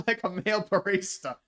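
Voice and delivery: high, laughing through the words; amused, laugh breaking into the line. Real, not acted. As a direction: crack up while saying it. Laughs at his own joke.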